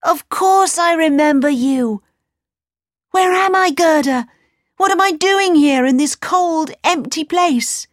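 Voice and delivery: put-on high, agitated boy's voice; crying out. A narrator's reading. Kay wakes to the cold palace, crying out. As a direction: exclaim as if waking in a strange place. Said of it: "Kay cried".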